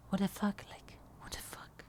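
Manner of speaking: breathless shocked whisper, almost no voice; stunned whisper. Retold or imitated. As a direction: hush it out like you can't believe it. Stunned when a girl grabs him.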